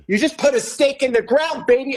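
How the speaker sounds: high-pitched, excited cheer in a retelling; mock-hyped, voice lifted high; retold excitement. Retelling how he cheered on the waiter's dream, excited.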